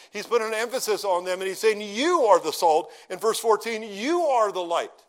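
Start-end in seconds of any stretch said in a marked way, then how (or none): 1.8-2.9 "You" leaned on hard, the rest slower; slow, emphatic "You are the salt"; stress on YOU. Acted. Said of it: "That's called emphatic". 3.8-5.0 "You" pressed, whole line slow; slow, emphatic "You are the light"; stress on YOU. Acted. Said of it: "Trying to make an emphasis there".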